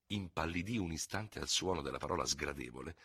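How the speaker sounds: ordinary delivery, nothing marked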